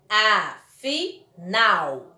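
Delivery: very slow "Afinal", A... fi... nal; drawn-out, chunked teaching demo of "Afinal"; split into syllables. Shown as a demonstration. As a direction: separate each syllable of "Afinal" clearly. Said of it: "Ó a separação da palavrinha afinal".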